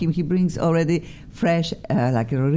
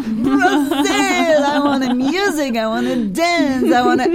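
high, exuberant party shout; whooping with festive excitement; excited cheer; 10 semitones higher, 4 dB louder than usual. Acted. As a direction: burst out as if calling for music and dancing. Host hypes guest's Brazilian origin.

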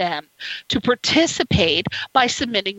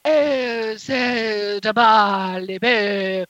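mock posh British; 3 semitones lower, much slower than usual. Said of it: "And they're like".

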